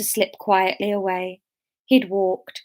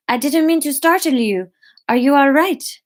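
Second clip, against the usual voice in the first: put-on higher, louder comic French accent; raised, put-on French voice; 5 semitones higher, 4 dB louder than usual. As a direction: check on someone in a French accent. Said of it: "we'll go French".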